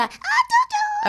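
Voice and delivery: put-on high squeaky baby-talk coo; dog voice. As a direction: squeak it like talking to a pup. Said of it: "the little squeak in my ear".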